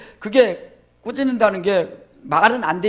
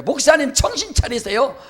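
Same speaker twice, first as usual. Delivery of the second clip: higher, mock-exasperated deacon; imitating a deacon; 7 semitones higher than usual. Pastor imitates a deacon telling him to wake up, higher. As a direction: play a deacon scolding his pastor. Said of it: "어떤 집사님 그러잖아요".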